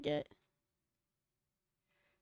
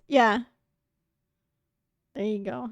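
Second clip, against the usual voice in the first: usual speaking voice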